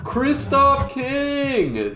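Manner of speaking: slow, high, stretched-out name; drawn-out hype call. Real, not acted. Announcing a chatter, drawn out.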